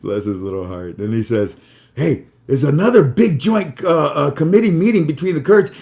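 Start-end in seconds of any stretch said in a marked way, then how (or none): 1.9-5.7 imitating a friend rattling off news, higher and louder; breathless rapid-fire excitement, pitched up; all excited. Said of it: "rapid firing what he's seeing because he's all excited".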